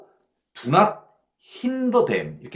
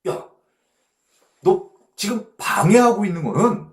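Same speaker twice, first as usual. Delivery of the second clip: rebuking Jesus; 6 semitones higher, 5 dB louder than usual. Acted. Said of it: "그랬더니, 오, 예수님께서".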